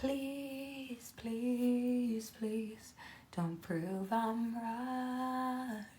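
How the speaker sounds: high, hushed, slow begging; soft pleading. Real, not acted. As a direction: beg softly, dragging it out. Pleading at the screen.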